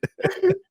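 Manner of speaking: a high, burst of laughter. Real, not acted. Laughing at his own poop story, high. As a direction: let the laugh fly up.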